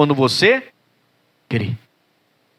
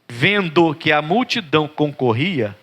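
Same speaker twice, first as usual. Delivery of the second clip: usual speaking voice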